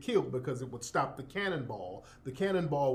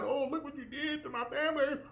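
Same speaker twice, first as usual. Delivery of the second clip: high, whiny voice, mocking a plea; mock a wailing victim begging for pity; mock crying plea; 11 semitones higher than usual. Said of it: "stop all that groveling and crying".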